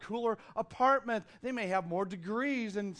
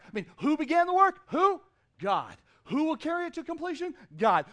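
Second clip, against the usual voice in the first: loud, fast rhetorical questions answered with God; preaching; 8 semitones higher, 9 dB louder than usual. Acted. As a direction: fire the questions at the congregation, then answer.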